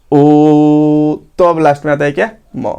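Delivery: ओ sustained long and even, then a short म; held ओ sound. Shown as a demonstration. Teacher showing students how ओ is held in ओम. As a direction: hold the ओ steady like a chant. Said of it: "तो ओ का जो उच्चारण हम कर रहे हैं".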